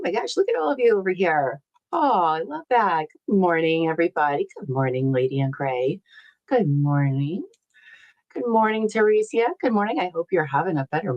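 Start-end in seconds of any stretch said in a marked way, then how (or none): none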